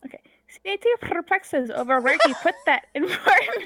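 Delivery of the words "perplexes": "perplexes" with a trilled, purring R; cat-like purr on the R of "perplexes"; rolled R purr. Acted. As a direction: roll the R in "perplexes". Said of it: "it actually sounds like a purr".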